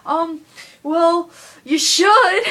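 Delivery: high, loud, slow, drawn-out mock apology; mocking the customer. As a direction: parody the guy as fussy and apologetic. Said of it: "And he was like".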